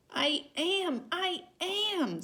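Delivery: very high, urgent insistence; silent shout. A narrator's reading. Sylvester crying out to his mother, very high and urgent. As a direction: plead to be heard. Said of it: "Sylvester wanted to shout".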